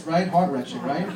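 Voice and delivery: usual speaking voice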